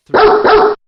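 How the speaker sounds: loud. Acted. Countdown suddenly shouted.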